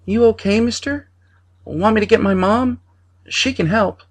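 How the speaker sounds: put-on high child voice full of worry; a small boy offering help; concerned little boy. A narrator's reading. Boy to coughing man, high worried child voice. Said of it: "the boy spoke with concern".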